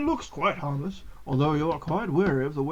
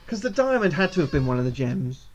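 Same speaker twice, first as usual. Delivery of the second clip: ordinary delivery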